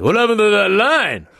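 loud, high demanding Stallone; Stallone impression. Stallone demanding the skipped line, loud impression. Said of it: "That's a fucking great Stallone".

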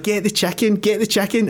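mock urgent high repeated call; hammed-up urgent plea to fetch the chicken; a neighbour urgently calling out about the chicken. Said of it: "they're like".

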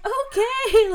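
high, loud defeated okay; exasperated okay. Retold or imitated. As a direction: squeal it, fully defeated. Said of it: "And I'm like".

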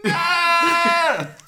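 goat bleat. Pretend. Another goat noise.

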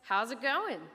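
mild calling-out yell; yelled greeting. Acted. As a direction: holler it cheerily across the water. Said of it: "He yells".

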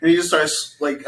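ordinary talk

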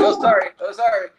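bumping into people, sorry sorry; apologetic. Retold or imitated. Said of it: "I'm like".

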